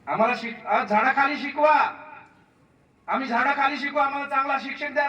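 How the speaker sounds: high, loud rallying cry; impassioned appeal. Real, not acted. Speaker urging parents to protest, impassioned. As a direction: push them to demand good teachers. Rousing crowd, demanding teachers.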